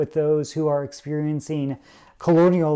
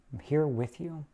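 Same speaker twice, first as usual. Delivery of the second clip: softer, gentle repeat of "I'm here with you"; 8 dB quieter than usual. Retold or imitated. Comforting a hurting friend, saying "I'm here with you" softly. Empathy voiced to a sufferer.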